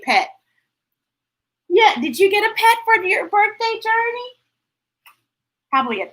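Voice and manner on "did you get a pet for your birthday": ordinary delivery, nothing marked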